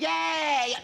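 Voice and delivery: high, drawn-out mock cheer; cheering liver. Host voicing the guest's liver at the drink, mock cheer. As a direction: cheer as a delighted body organ. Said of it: "your liver's gonna be going".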